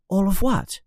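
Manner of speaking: put-on high, small puzzled child voice; high-pitched confused question; confused boy. A narrator's reading. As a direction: ask in bewilderment as a little boy. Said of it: "very confused now".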